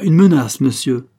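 high, loud, indignant hauteur; supremely haughty. A narrator's reading. Lady turns on the suitor who threatens, haughty. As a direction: ring it out with supreme disdain. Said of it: "avec une hauteur suprême".

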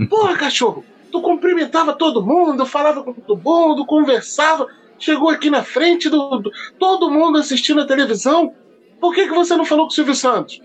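hammed-up exasperation at the silent dog; mock angry rant. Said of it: "o cara". (bronca exagerada e aguda no cachorro)

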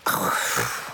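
breath let out in frustration; giving-up exhale; exasperated sigh. A narrator's reading. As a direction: break off into a huffed sigh. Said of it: "ze zucht".

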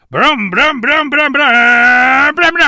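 high, loud engine brum brum; motorbike noise. Acted. Zombie biker roaring in on his scooter, engine noises. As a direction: rev the scooter with your mouth.

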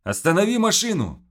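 loud, high shout. Acted. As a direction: yell to stop the car. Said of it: "крикнул Макс".

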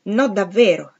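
quick outburst of objection, narrated; exclaimed protest. A narrator's reading.

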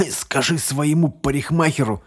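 hoarse. A narrator's reading. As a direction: croak out a taunt. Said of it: "прохрипел он".

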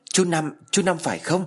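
high, frantic call; uncle's name called out high and urgent; anxious call. A narrator's reading. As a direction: call out while shaking him. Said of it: "vừa gọi vừa lay người".

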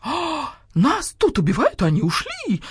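gasping, high-pitched protest; indignant. A narrator's reading. Rooster hears the guard dogs have left, indignant. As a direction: open high with affronted disbelief. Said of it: "возмутился Петюня".

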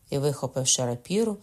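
ordinary delivery, nothing marked

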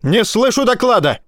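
high, barked reprimand; harsh barking commands. A narrator's reading. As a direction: bark the complaint. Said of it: "вдруг рявкнул он".

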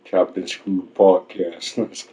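very slow, dragged announcer read; chopped and screwed drawl. Acted. Naming the podcast in a slowed-down screwed style. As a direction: stretch every word like a slowed-down track.

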